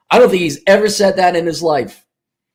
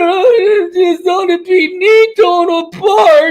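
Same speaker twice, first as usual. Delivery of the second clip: much higher, louder, slow sobbing plea; crying plea; 5 dB louder than usual. Pretend. Client begging Dave not to hurt him, sobbing. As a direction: blubber out a desperate plea.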